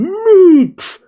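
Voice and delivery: high, loud comic yell; screaming awake. Retold or imitated. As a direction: belt it out far above normal pitch. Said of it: "Luffy awakens screaming".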